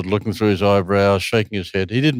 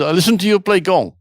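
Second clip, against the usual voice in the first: higher-pitched imitation of a German accent; bad German accent; 7 semitones higher, 4 dB louder than usual. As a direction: retell it in halting German-accented English. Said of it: "in my worst German accent".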